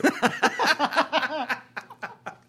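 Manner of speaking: high, long burst of laughter; cracking up; laughing. Real, not acted. Laughing after the joke.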